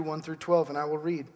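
normal talking voice

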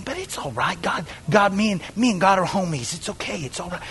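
soft mock reassurance; hushed excusing. Sinner claiming to be homies with God, hushed excuse. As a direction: murmur it away, very soft. Mimicking casual excuse for sin.

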